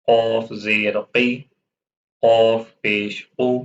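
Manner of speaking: slow, deliberate letter-by-letter recitation; slow recitation drill. Acted. Quran teacher drilling pupils on the vowel signs, slow and measured. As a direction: chant each syllable slowly for pupils to repeat.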